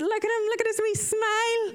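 high fond cooing; doting. Retold or imitated. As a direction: gush over him fondly. Doting on smiling patient.

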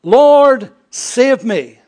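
loud, high cry for help; cried out. Acted. Peter sinking, crying to Jesus: loud high cry. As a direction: call out loud like a man sinking. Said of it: "he cried out".

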